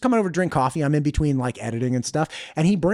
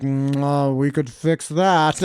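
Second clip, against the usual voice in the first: Russian doctor impression, drawn out at the end; slow down into a heavy Russian accent; thick Russian accent; 2 semitones lower, much slower than usual. Doctor reassuring his patient, thick Russian accent. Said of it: "Russian guy with this thick Russian accent".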